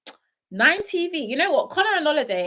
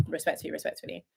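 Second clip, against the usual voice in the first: whispered aside; 6 semitones lower, 10 dB quieter than usual. Real, not acted. Repeats word, trailing to whisper.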